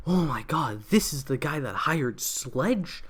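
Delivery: slow, drawn-out dramatic shock; mock amazement. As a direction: play up a big astonished reveal. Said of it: "It wasn't like".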